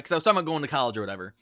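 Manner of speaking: ordinary talk, nothing marked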